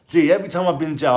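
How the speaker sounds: ordinary talk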